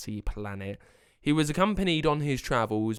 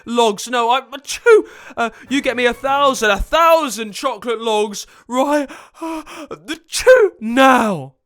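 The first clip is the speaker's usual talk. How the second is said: loud, high demands broken by sneezes; sneezing wizard; 13 semitones higher, 12 dB louder than usual. Acted. Wizard allergic to bunnies demanding logs, sneezing fit. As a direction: shout orders between explosive achoos. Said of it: "he was sneezing too much".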